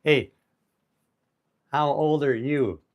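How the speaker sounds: normal talking voice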